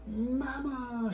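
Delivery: high, loud joyful call; shout. Acted. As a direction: call out across the arrival hall. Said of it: "shouted Astrid".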